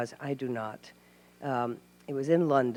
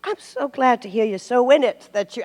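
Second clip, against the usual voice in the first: put-on John in a Yorkshire accent; 6 semitones higher, 8 dB louder than usual. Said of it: "you can hear my Yorkshire accent".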